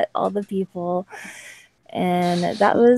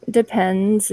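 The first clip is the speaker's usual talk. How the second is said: ordinary talk, nothing marked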